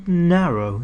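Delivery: ordinary delivery, nothing marked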